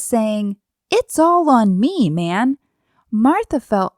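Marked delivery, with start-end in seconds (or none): 0.9-2.6 loud, high outburst that drops away; acted frustration; exasperated complaint. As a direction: push out an overburdened gripe. Voices overburdened Martha's complaint.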